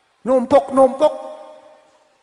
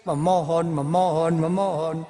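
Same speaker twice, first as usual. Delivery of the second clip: put-on child begging; 5 semitones lower than usual. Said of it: "Kayak di T V ada tuh".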